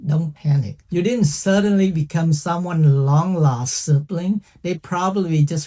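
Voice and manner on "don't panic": normal talking voice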